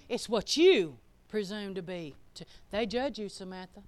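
normal talking voice

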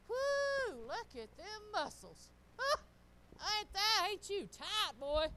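high, loud whoop of mock admiration; hammed-up gushing over his muscles; gushing praise. Wife praising husband carrying groceries, hammed-up admiration.

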